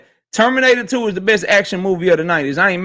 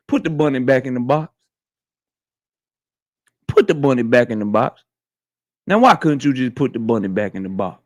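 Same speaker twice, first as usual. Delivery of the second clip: movie quote impression; 10 semitones lower than usual. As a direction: drop the voice deep like a film hero quoting his line. Quoting Nicolas Cage movie lines.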